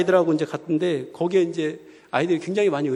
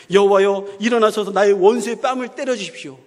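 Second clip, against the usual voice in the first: louder, forceful appeal to God; emphatic quoted prayer; 3 semitones higher, 5 dB louder than usual. Retold or imitated. Preacher recalls a favourite psalm against enemies, forceful appeal. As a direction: pray it out insistently, a bit higher.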